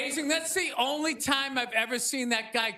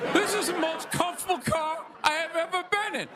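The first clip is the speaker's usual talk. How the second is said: higher, a different pitchman voice; Shaq car ad; 4 semitones higher than usual. Retold or imitated. Shaq cramped in a Buick, ad voice. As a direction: sell it like a car commercial. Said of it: "mid-sized Buick Century".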